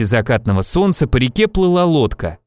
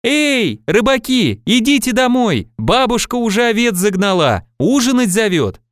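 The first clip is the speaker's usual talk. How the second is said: calling from afar; 8 semitones higher than usual. A narrator's reading. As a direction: call to someone in the distance. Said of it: "закричала издалека". (высокий протяжный оклик издалека)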